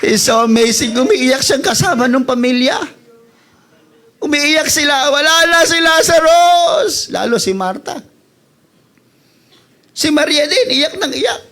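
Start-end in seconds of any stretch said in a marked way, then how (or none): 0.0-7.1 loud, high shouted preaching; fervent preacher shouting God's sovereignty; full-force preaching. Acted. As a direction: preach it to the rafters. Preacher in worship over music.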